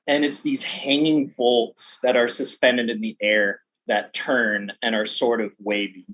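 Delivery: ordinary talk, nothing marked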